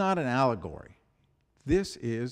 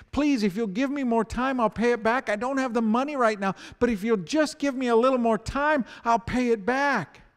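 higher, urgent begging for time; pleading; 7 semitones higher, 5 dB louder than usual. Retold or imitated. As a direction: beg on your knees for more time. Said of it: "he begins pleading".

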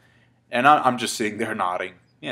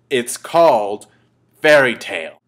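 louder, building up to the big reveal; excited build-up; 7 dB louder, slower than usual. Retold or imitated. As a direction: announce the title like a grand reveal. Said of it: "'cause he's getting excited".

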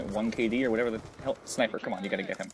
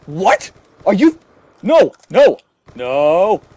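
loud, much higher disbelieving shout; outraged yell; 7 semitones higher, 15 dB louder, much slower than usual. Real, not acted. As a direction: scream no at the screen. Outraged at the game.

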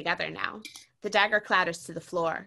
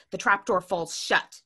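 ordinary delivery, nothing marked

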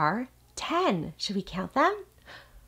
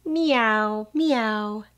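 cat meow; 3 semitones higher than usual. Pretend. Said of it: "What does a cat say?".

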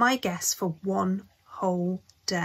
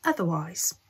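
ordinary delivery

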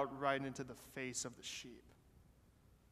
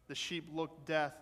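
ordinary delivery

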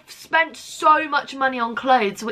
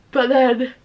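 talking through a yawn; 2 semitones higher, 4 dB louder than usual. Real, not acted. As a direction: say it mid-yawn. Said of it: "sorry, I keep yawning".

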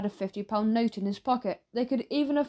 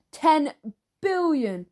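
higher, louder, split into two bursts; astonished exclamation; 5 semitones higher, 4 dB louder, slower than usual. Acted. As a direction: blurt it in amazement, pausing after TEN. Shocked reaction to the figure.

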